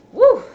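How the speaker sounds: loud, high "woo"; big high exhale of relief; high recovering whoop. Real, not acted. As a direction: whoop it out after coughing and sweating.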